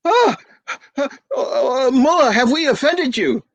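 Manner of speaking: panicked host begging pardon; father afraid he insulted the mullah; flustered father. Acted. Father alarmed by guest.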